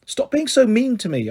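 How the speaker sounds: high, hurt protest at her friend; girl protesting to her bullying friend, upset; upset plea. Acted. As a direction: tell her to stop, wounded. Girls quarrel, pushing.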